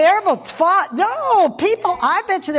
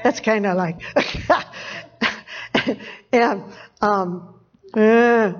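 ordinary talk

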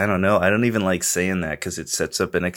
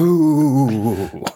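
spooky voice; 6 semitones higher, 5 dB louder than usual. Retold or imitated. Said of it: "the spooky voice".

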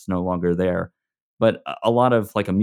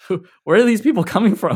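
incredulous, pitched well up; baffled disbelief; 12 semitones higher, 6 dB louder than usual. Real, not acted. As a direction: ask it in high-pitched bafflement. Baffled by own huge audience.

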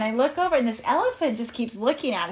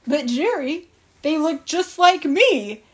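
higher, slow, puzzled animal voice; confused animal; 5 semitones higher, 5 dB louder, slower than usual. Acted. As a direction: protest slowly as a bewildered storybook animal. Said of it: "he looks confused".

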